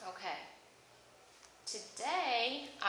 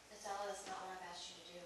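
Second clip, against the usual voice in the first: quiet scolding; 3 semitones lower, 13 dB quieter than usual. Real, not acted. Teacher scolds student softly.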